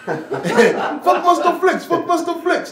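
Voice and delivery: high, loud, drawn-out mock fan shouting the name; hammed-up starstruck cry of a DJ's name; excited fan calling out. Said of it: "That's all I could hear".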